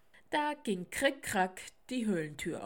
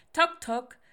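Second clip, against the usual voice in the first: loud, higher knock imitation; 4 semitones higher, 10 dB louder than usual. A narrator's reading. Narrator imitates loud knocking at the owl's door, loud and sudden. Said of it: "klopfte es laut an der Tür".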